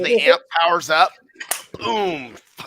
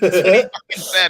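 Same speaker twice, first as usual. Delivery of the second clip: laughing; 4 semitones higher, 7 dB louder than usual. Real, not acted. Laughing loudly while retelling.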